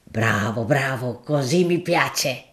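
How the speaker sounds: a deferential, earthy shopkeeper making his offer; grocer Gaudenzio. Acted. Gaudenzio pleased.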